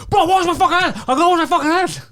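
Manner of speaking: high, loud, fast, laughing yell; impatient bouncer. Retold or imitated. Poseidon yells from the toilet, high and laughing. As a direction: bark it out from behind a door, cracking up.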